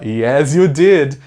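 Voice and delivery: lightly acted insistent comeback; play-acted sitcom retort, emphatic; Monica from Friends. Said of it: "Ela fala".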